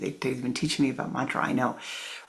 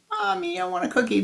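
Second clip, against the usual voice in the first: put-on much higher child voice; changed voice; 3 dB louder, faster than usual. Shown as a demonstration. Boy showing how his voice changed. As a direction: squeal it up high. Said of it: "my voice changed".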